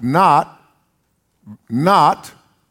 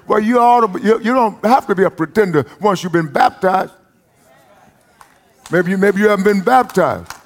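preaching like he feels it; 4 semitones higher than usual. Acted. Preacher to the congregation, fervent near-sung preaching. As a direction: let the feeling carry it. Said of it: "Can I preach like I feel it?".

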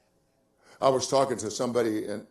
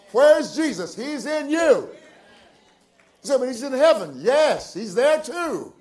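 high, loud, exultant pulpit voice; full-force preaching; 10 semitones higher, 8 dB louder than usual. Acted. Preacher assuring believers they have already won, full-force preaching. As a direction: proclaim victory, stretching the key words.

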